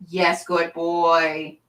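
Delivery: slow, tender praise; drawn-out pet talk; puppy praise. Real, not acted. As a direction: draw it out fondly for a puppy.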